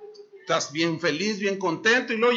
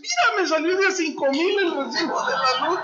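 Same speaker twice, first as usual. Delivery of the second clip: mock boasting; 8 semitones higher, 4 dB louder than usual. Boasting to neighbor.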